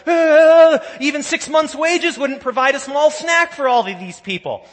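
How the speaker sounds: high, loud panicked wail; imitating Philip in a flustered panic; freaking out. Preacher imitating anxious Philip, high and loud in a mock panic. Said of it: "he's freaking out and he's like".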